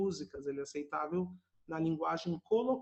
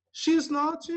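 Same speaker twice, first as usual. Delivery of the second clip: higher and louder, bright model; excited model; 10 semitones higher, 9 dB louder than usual. Shown as a demonstration. Teacher calls out the contracted form much higher and louder.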